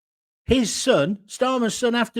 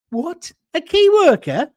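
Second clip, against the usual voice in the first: incredulous; 7 semitones higher, 5 dB louder than usual. Real, not acted. Mocking a claim in a rant.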